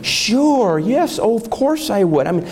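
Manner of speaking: high, gushing mock politician; sneering parody of candidates who fold, high and eager. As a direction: ham up their eager spineless yes, voice pitched well up. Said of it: "Every one of them".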